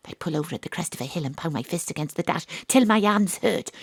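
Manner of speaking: usual speaking voice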